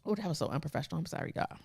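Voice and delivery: soft, raspy dry-throated apology. Real, not acted. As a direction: speak through a parched throat. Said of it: "my throat is so dry".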